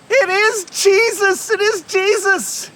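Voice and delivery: high, slow, stretched exclamation; excited realization. Acted. Fisherman recognizing Jesus on the water, excited cry. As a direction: cry it out in amazement, high and drawn out.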